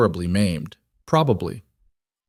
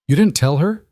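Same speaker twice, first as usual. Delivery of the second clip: delighted; 7 semitones higher, 4 dB louder than usual. A narrator's reading. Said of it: "Vera sounded delighted".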